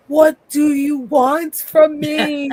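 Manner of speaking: high, slow loud yell; hammed-up anguished scream; mock yell. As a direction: bellow it like the classic scream. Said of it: "yelling, screaming line".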